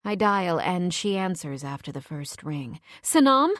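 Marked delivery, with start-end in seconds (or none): 3.0-3.5 high, loud cry of relief; voice cracking with relief. A narrator's reading. Woman reaches her friend by phone after the shooting, cracking with relief. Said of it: "My voice cracks with relief".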